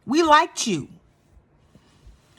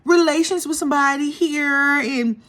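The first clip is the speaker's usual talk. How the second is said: mocking Brynn; 6 semitones higher than usual. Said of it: "what she said".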